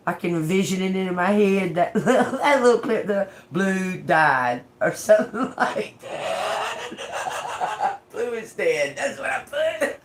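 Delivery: talking through real giggles; helpless laughter breaking into the words; laughing. Real, not acted. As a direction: break up laughing mid-sentence. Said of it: "Boy, I'm laughing, like, right now".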